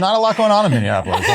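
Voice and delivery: fast teasing with a laugh; laughing while teasing. Real, not acted.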